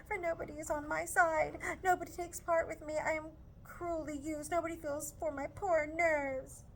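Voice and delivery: melancholy tone. Acted. Mrs Bennet complaining to Charlotte, mild melancholy whine. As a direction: moan about her nerves, a touch of melancholy. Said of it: "she added in a melancholy tone".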